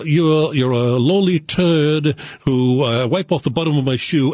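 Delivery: lightly mock a snooty British bureaucrat, looking down his nose; upper crust British accent. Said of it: "when they talk in that upper crust British accent".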